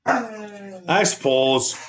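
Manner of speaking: very slow, stretched Wisconsin "I suppose"; Wisconsin drawl. Pretend. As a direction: drag it out like a Midwestern uncle. Wisconsin joke, drawn out.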